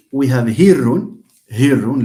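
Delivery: hirrun with a buzzing voiced h, loud; voiced Arabic h. Shown as a demonstration. Teacher to class, demonstrating the voiced Arabic h in hirrun. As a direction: press voice through the h of hirrun. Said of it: "h is voiced in Arabic".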